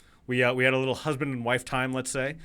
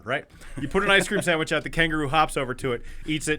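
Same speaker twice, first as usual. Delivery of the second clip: usual speaking voice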